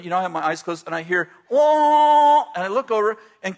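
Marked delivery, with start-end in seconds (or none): 1.5-2.4 sudden cry. Retold or imitated. Kathy's outburst in worship.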